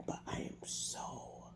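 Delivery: sudden voiceless whisper. Real, not acted. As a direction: let it drop to a whisper. Overwhelmed, drops to whisper.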